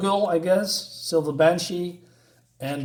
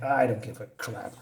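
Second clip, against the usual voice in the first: low, soft, flat-out uncaring mutter; deadpan indifference; 5 semitones lower, 7 dB quieter than usual. Retold or imitated. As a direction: throw it away like you couldn't care less. Superman's indifferent reply, voiced.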